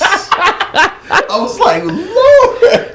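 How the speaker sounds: high voice through laughter; laughing. Real, not acted. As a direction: say it while cracking up.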